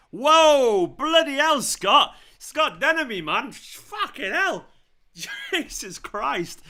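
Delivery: high, loud shocked yells at a goal; real astonishment bursting out; stunned outburst. Real, not acted. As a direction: explode in disbelief, then stretch the curses out slowly.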